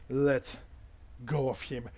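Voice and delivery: measured stern order, with pauses; stern guard. Acted. Big spear guard confronts the intruder, stern and measured. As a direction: order it firmly, without raising the voice. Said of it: "he seems very, um, stern".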